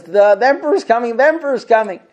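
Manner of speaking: high, loud, rushed excited announcing; all excited. Retold or imitated. Apostate eagerly announcing the emperor to Rav Yosef, high loud excitement. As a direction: blurt it out like the big moment is here. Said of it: "and the guy gets all excited".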